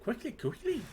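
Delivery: high-pitched little creature hurrying everyone; high, urgent kobold voice. Acted. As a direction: play a kobold urging haste. Kobold urging the party on.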